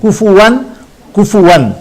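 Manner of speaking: كفوا said kufuwan with no glottal stop; without hamza. Shown as a demonstration. Teacher contrasts readings with and without hamza, no glottal stop. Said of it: "يقرأ بغير همز".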